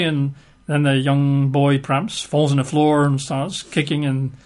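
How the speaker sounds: ordinary talk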